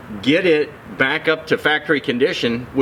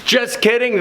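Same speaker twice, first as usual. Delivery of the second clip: playful; 10 semitones higher than usual. Real, not acted. After fake radio intro.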